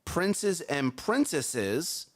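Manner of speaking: drawn out very slowly; slow careful. Shown as a demonstration. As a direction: stretch out princes and princesses. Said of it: "slow repeat".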